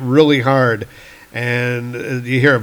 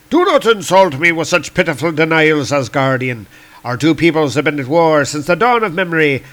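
higher, forceful comic-book warrior; indignant Celtic god; 3 semitones higher, 3 dB louder than usual. Acted. Angry Lear confronting Thor, indignant declaiming.

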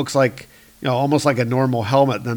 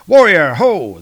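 hailing call; 5 semitones higher, 8 dB louder, slower than usual. Acted. Hailing a rider in comic.

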